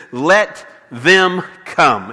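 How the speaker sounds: slow, clipped words, each one hit apart; sharp staccato. Retold or imitated. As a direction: lay down each word slowly, one at a time. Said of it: "respond very sharply in staccato statements".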